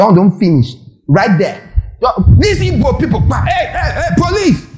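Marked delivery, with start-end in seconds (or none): 2.0-4.7 very high-pitched mock outcry; acted alarmed call. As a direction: call out high as if summoning police.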